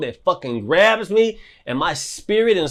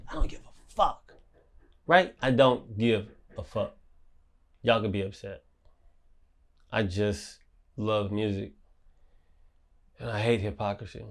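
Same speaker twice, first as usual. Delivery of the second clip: much softer, low, dismissive; quiet defiance; 6 semitones lower, 9 dB quieter than usual. Real, not acted. Telling critics he does not care, low and soft. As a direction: drop your voice and shrug them off.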